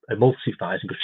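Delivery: normal talking voice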